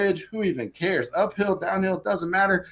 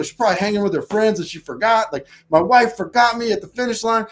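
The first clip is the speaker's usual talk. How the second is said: retold anger; 6 semitones higher, 5 dB louder than usual. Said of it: "I start getting angry".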